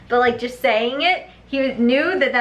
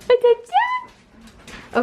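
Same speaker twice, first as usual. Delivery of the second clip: much higher, singsong questions to the dog; high dog-talk voice. Acted. Baby-talking to dog on clip.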